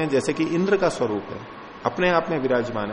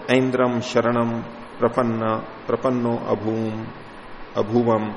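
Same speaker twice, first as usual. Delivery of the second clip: slow Sanskrit recitation; 3 semitones lower, slower than usual. Acted. Sanskrit verse chanted slowly.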